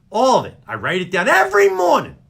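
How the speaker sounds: high, emphatic rant; worked up. Real, not acted. Host rants about his morning list, worked up. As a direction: hammer it out with mounting agitation.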